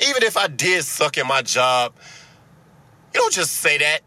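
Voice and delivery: ordinary talk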